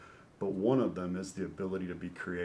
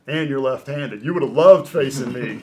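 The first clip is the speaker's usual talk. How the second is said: loud, higher-pitched mock protest; incredulous retort; 4 semitones higher, 15 dB louder than usual. Pitcher retelling his comeback to a batting legend, loud incredulous retort. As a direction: throw it back loudly in disbelief.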